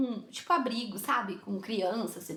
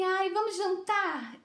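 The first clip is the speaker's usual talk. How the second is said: slow, higher put-on invitation; imitating the woman; 7 semitones higher, much slower than usual. The woman suggesting dinner to the narrator, mock coaxing. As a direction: mimic her, dragging out the question. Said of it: "Aí ela falou assim".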